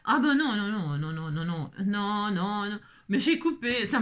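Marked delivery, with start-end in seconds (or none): none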